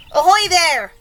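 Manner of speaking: loud, high hail; police chief's shout. A narrator's reading. Police chief hails the peach, shouting. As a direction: call up to someone far overhead. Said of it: "shouted the chief of police".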